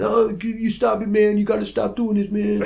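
high-pitched imitated threat; mock warning in a put-on high voice; angry man in car. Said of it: "pointed at the guy out the car window going".

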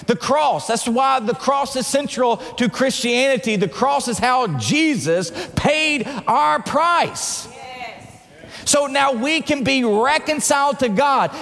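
high, driving sermon climax; ringing, emphatic preacher's delivery; impassioned preaching. Real, not acted. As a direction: proclaim it with full conviction. Preaches at full force.

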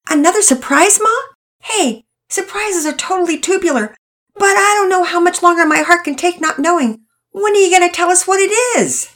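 high, loud, eager pleading; can barely wait to hear; impatient excitement. A narrator's reading. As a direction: Todd begging his mom for the surprise. Kid impatient for big news.

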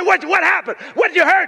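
high, loud, stumbling questions; urgent questioning. Retold or imitated. Father asking his crying daughter what hurts, high and loud. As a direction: fire worried questions at a hurt child.

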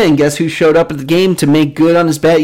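ordinary delivery

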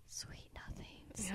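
breathy slow whisper close to the ear. Retold or imitated. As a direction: whisper it softly and slowly, teasing. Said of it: "whisper sweet nothings into your ear".

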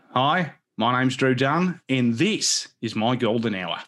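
slow, drawn-out Aussie intro; Australian accent. Pretend. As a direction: play it like a laid-back Australian host. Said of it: "maybe we can take it down under".

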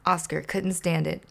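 ordinary delivery, nothing marked

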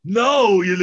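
put-on loud, high drunken slurring; hammed-up drunken hollering; mock drunk. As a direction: call out like someone suddenly wasted. Acts out trashed people calling Pete.

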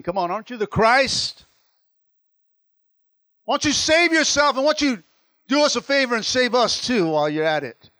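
taunting. Acted. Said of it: "talking a lot of smack".

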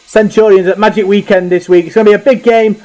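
upbeat host intro voice; podcast presenter read. Acted. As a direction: announce it like a radio host. Presenter reads match preview.

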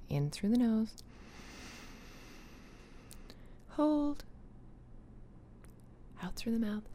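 soft whispered breathing cues; guided breathing. Acted. Leads a breathing exercise.

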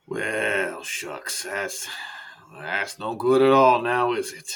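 sag with a drawn-out sympathy; let-down doctor. Acted. Doctor hears patient can't afford it, deflated.

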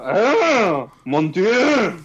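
put-on high, hammy French accent gasp; Poirot French exclamation. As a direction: gasp it like a theatrical Belgian detective. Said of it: "He would go".

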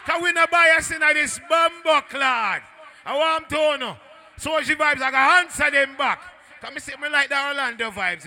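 high, loud MC shouting; hyping the crowd. Real, not acted. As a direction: keep hollering to the dance, easing slightly by the end.

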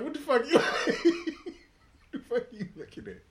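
high, loud opening collapsing into laughter; retold indignation broken by laughs; laughing mock challenge. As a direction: crack up while acting offended.

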